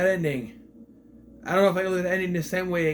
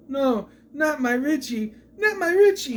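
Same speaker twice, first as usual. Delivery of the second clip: soap opera wailing; 9 semitones higher, 3 dB louder than usual. Retold or imitated. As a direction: overact grief like a soap actress. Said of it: "What is this soap opera acting?".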